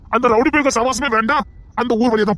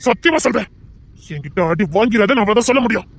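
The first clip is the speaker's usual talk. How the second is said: ordinary delivery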